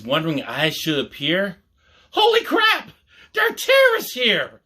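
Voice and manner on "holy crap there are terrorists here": a startled yelp of panic, way up high; alarmed shout. A narrator's reading. John spotting terrorists at the party, a high shocked shout.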